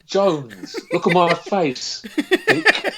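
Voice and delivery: squeaky high laughing voice on Dick; laughing film quote. Retold or imitated. Hamming a film line to the co-host, with a squeaky laugh. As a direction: crack up while doing the villain. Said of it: "That was great".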